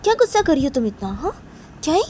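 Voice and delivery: loud, high, snappy challenge; heated retort. Acted. Friend snapping back at Nisha about her temper, loud high retort. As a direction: throw it back at her, irritated. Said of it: "तो निशा बोलती".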